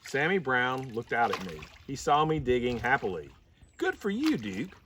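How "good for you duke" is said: put-on delighted boy. A narrator's reading. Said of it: "Sammy cried".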